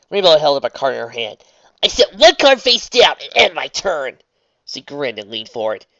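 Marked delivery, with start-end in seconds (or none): 1.8-4.2 put-on brash, loud sporty girl; confident loud challenger; tomboy voice.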